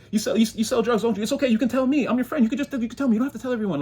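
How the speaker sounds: high, sweet fake friendliness; coaxing nosy friend. Nosy neighbour coaxing a confession, sugary high. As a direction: wheedle a secret out of them.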